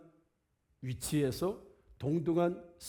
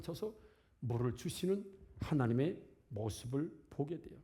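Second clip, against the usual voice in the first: much softer and lower, soothing; calm him down softly like a loving father; gentle calming; 6 semitones lower, 3 dB quieter than usual. Acted. God soothing an angry Moses, soft and low.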